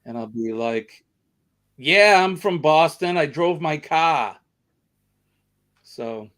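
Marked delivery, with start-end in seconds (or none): 1.8-4.4 loud, higher, hammed-up Boston accent; exaggerated Boston accent. Host answering strangers, loud put-on Boston accent. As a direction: play up the Boston accent, dropping the R. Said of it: "my Boston accent".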